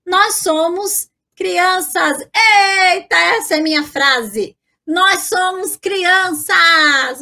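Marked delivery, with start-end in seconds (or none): 4.9-7.2 drawn-out, playful exclamation; gleeful cheer. Pretend. Teacher claiming the phrase as her own, gleeful cheer. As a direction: cheer it out slowly like a happy kid.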